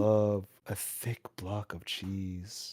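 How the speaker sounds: low, slow and soft rat voice; deep VTuber voice. Pretend. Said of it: "in a deeper voice".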